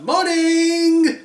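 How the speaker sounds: high, loud bright greeting; cheery shout. Acted. Cinderella cheerfully shouting morning at hungover sisters. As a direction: sing out a loud good morning. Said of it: "would you shut up shouting".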